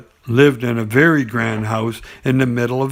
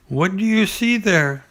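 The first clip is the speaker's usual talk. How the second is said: Piglet voice; 7 semitones higher than usual. Acted. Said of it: "said Piglet".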